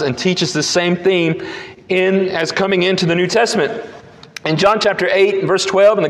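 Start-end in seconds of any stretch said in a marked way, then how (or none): none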